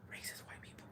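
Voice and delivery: very soft, voiceless confidential aside; whispered aside. Real, not acted. Speaker naming who lives back home, whispered aside. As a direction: whisper it under your breath. Sensitive aside about hometown.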